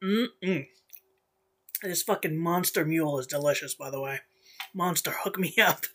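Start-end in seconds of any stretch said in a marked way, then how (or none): none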